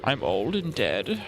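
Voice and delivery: lightly voiced frail old man, slow at the end; mock elderly croak; old Pope voice. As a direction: drag it out like a dying old man. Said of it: "He goes".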